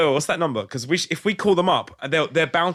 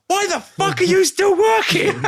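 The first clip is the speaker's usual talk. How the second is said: high, stretched-out incredulous yell; retold shout; 4 dB louder, slower than usual. Retelling a four-in-the-morning helpline call, incredulous shout. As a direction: re-enact the shout, much higher and slower. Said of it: "And I just shouted".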